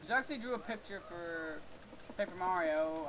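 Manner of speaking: ordinary delivery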